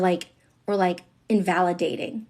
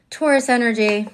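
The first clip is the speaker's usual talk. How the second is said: ordinary talk, nothing marked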